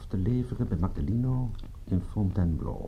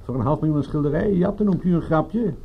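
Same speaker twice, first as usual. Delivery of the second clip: higher, indignant disbelief, faster at the end; incredulous scoff; 7 semitones higher, 4 dB louder than usual. Acted. Detective mocks the excuse for the stolen paintings, incredulous. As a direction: throw the word joke back at them.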